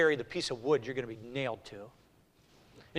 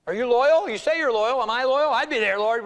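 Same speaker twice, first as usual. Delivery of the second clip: high, loud pulpit challenge; raised, forceful sermon voice; impassioned preaching; 9 semitones higher, 7 dB louder than usual. Real, not acted. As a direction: fire the questions at the congregation.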